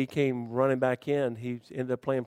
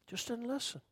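dropped to a much softer repeat; soft echo; 11 semitones higher, 11 dB quieter than usual. Shown as a demonstration. Preacher repeating the lesson to the congregation, much softer. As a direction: let the words sink in softly.

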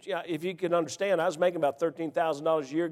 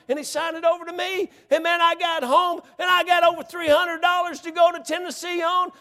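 rising excitement as the money piles up; excited climax; 9 semitones higher than usual. Real, not acted. Preacher tells how the gas money came in, excited and higher.

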